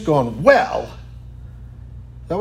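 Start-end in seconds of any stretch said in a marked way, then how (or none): 0.4-0.9 high, hammed-up huff of indignation; mock indignant. Woman confronting Jesus with hands on hips, mock indignant. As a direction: imitate someone huffy with hands on hips. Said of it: "was indignant before him, hands on hips".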